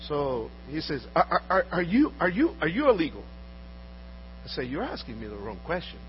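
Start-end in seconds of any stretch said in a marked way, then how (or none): none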